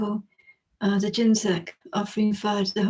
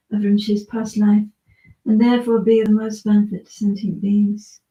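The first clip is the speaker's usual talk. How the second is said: steady, earnest prayer reading; prayer recitation; 4 dB louder than usual. Acted. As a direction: recite the dedication prayer with devotion. Recites dedication prayer aloud.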